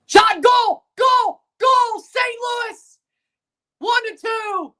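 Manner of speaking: high excited yelling; real fan goal scream; goal celebration shout. Real, not acted. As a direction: erupt for the home goal. Goal celebration while watching hockey.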